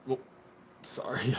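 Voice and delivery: ordinary delivery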